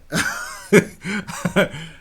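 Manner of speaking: high-pitched chuckle; amused laugh. Real, not acted. Reacting to the apology clip, amused laugh. Scoffs at clip's blanket apology.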